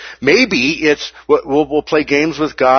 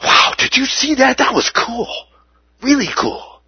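high, amazed, starry-eyed voice; awestruck wonder; 11 semitones higher than usual. Acted. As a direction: marvel like a child seeing fireworks. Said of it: "Gasping in awe".

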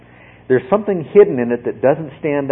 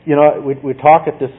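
ordinary talk, nothing marked

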